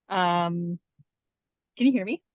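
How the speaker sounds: usual speaking voice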